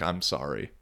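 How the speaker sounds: low, soft and sorry; a quiet compassionate sorry; soft apology. Retold or imitated. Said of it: "Or kinda like".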